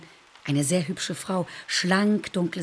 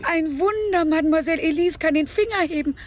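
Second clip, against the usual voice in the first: higher, delighted astonishment; amazed; 7 semitones higher, 4 dB louder than usual. Acted. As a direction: marvel at the little miracle. Amazed patient can move finger.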